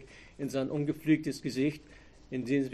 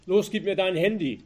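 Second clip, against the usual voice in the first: curt order, higher and louder; commanding; 5 semitones higher, 7 dB louder than usual. A narrator's reading. Robber demanding Anke's phone, commanding. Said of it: "kommandierte er".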